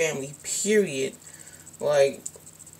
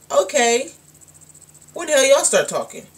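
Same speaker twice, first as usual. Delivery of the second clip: raised, disbelieving question; incredulous; 7 semitones higher, 5 dB louder than usual. Retold or imitated. Scoffing at old acquaintances, incredulous and raised. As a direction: scoff at people suddenly talking to you.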